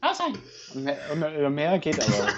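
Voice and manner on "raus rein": high squeaky voice of a flaky router; mock Wi-Fi voice. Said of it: "Dieses W lan ist quasi ungefähr so, es macht die ganze Zeit".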